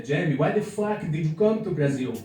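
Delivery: put-on a light Brazilian accent on the question; imitating a Brazilian asking in a Brazilian accent. Brazilians asking the comic why he came, in a Brazilian accent. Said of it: "which has a really good Brazilian accent".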